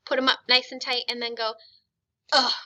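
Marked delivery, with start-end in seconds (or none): 2.3-2.5 brief disgusted grunt of "ugh". Shown as a demonstration. Teacher repeating schwa trick with class, disgusted. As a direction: release tight shoulders into an uh. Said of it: "like you're disgusted at something".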